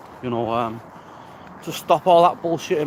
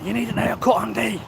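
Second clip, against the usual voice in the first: mock nagging voice, pitched higher; nagging inner voice; 7 semitones higher than usual. His head nagging him on a walk, higher mock voice. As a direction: nag himself like a pushy inner critic. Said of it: "my head's going like that".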